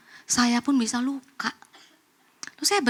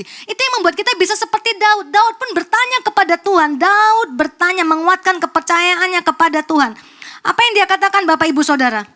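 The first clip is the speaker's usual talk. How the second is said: raised, louder pulpit voice; forceful preaching; 5 semitones higher, 7 dB louder than usual. Real, not acted. Preacher urges congregation to follow David, forceful proclamation. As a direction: proclaim it with full force to the crowd.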